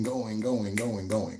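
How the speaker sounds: "going, going, going, going" drawn out and fading; repeated for emphasis. Shown as a demonstration. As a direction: let each "going" fade away, long gone. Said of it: "the way you say it".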